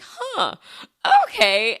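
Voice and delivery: high huh, then a loud okay; thrown, mentally recalibrating; puzzled surprise. Retold or imitated. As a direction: register the mismatch, then accept it loudly. Inner reaction to mechanic's looks.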